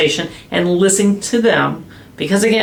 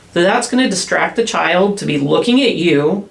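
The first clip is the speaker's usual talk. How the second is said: ordinary delivery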